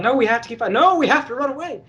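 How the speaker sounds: panicked henchman. Retold or imitated. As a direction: squeak it out like a scared grunt. Mooks arguing to flee.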